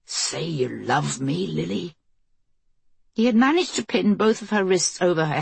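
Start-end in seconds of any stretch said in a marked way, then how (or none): 0.0-2.0 low, soft menacing mutter. Acted. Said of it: "Greg was muttering".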